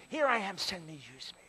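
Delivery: normal talking voice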